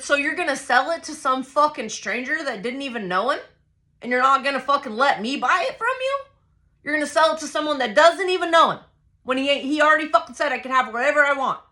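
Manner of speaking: high, rushed, incredulous outrage; outraged protest. Retold or imitated. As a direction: argue it fast and high, fed up. Acted escalating argument.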